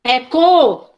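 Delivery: a clear isolated "co" as a teaching demo; syllable co isolated. Shown as a demonstration. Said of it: "palavras que, que tem a sílaba".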